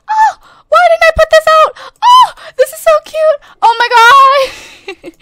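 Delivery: high, loud squeal of delight; real overjoyed high-pitched outburst; excited squeal. Real, not acted. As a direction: shriek at how cute it is. Thrilled at finding cute decoration.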